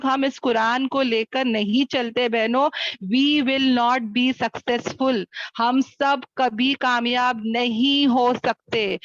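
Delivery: slow, deliberate, word-by-word warning; slow and emphatic. Real, not acted. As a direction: drive each word home slowly. Driving home a solemn warning.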